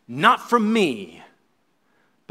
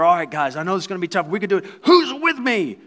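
rallying captain; 3 semitones higher, 4 dB louder, faster than usual. Retold or imitated. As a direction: build to the question. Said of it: "the captain of that regiment will get the troops together".

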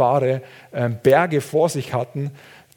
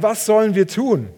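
pitched up high and louder, urgent plea; desperate cry to God; 8 semitones higher, 4 dB louder than usual. Retold or imitated. Elisha crying out to the Lord, high and urgent. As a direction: cry out for help, voice climbing high. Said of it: "Und er ruft zum Herrn".

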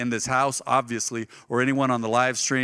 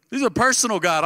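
loud and high, laughing through it; laughing exclamation; 6 semitones higher, 5 dB louder than usual. Real, not acted. Preacher repeating the line to the congregation with a loud laugh. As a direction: burst out with joy and a laugh. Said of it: "between your grandma and her God".

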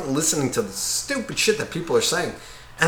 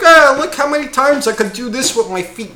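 higher-pitched mock boasting; mocking show-off; 7 semitones higher than usual. Soccer show-off in front of someone's blanket, mock bragging. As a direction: imitate an eager braggart.

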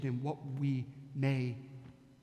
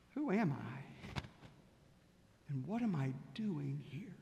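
very soft, slow, whispered questions; bedtime whisper; 3 semitones lower, 8 dB quieter, slower than usual. Acted. Person asking themselves at bedtime before the pillow, whispered. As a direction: murmur it as if drifting off to sleep.

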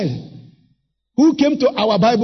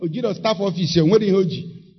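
ordinary talk

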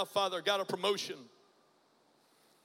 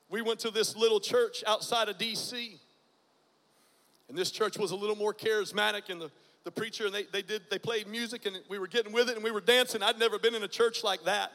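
ordinary delivery

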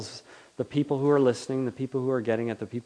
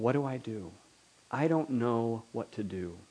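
soft admission; 7 dB quieter than usual. Acted. Humble admission of not knowing.

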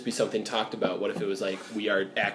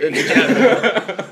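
laughing; 9 semitones higher, 9 dB louder, slower than usual. Real, not acted. Laughing slow repeat.

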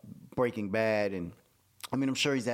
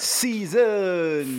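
announcer voice; 6 semitones higher, 7 dB louder than usual. Pretend. Intro announcer voice.

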